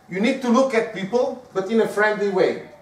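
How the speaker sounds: ordinary delivery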